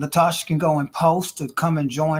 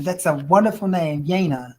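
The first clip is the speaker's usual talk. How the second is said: ordinary talk, nothing marked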